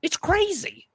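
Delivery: high Vince Vaughn line; Vince Vaughn impression.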